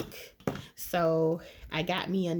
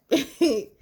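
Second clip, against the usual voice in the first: laughing through sour candy; high laugh at the sour taste; 8 semitones higher, 8 dB louder than usual. Real, not acted. Sour candy hits hard.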